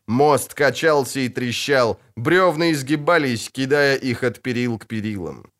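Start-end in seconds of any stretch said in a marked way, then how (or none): none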